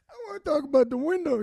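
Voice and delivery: put-on cracking up while quoting the old father; laughing retelling. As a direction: laugh your way through the line.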